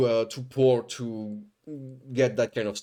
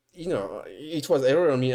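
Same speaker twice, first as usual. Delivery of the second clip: ordinary talk, nothing marked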